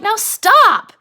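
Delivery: sharp high protest, loud on STOP; acted yell. Curly's wife pulling away from Lenny, yelling in protest. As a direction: raise your voice to make him quit. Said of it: "she starts to yell at him".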